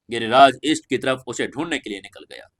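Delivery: ordinary delivery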